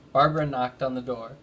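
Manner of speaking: ordinary delivery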